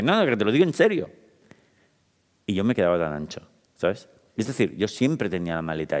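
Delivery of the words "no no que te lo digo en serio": más agudo y enfático; insistente. Retold or imitated. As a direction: insiste en que va en serio. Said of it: "Y yo decía". (insistiendo a la jefa, agudo y firme)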